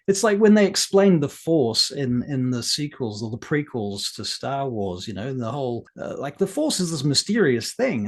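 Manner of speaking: normal talking voice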